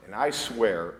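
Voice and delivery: normal talking voice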